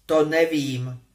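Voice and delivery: short clipped snap; curt retort. A narrator's reading. Harry snapping back at Ron's question, curt. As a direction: cut him off. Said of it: "odsekl Harry".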